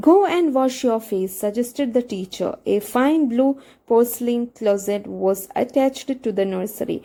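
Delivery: reads the English text slowly, word by word; slow text reading. Acted. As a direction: spell it out slowly for the students. (പതുക്കെ വായിക്കുന്ന പാഠം)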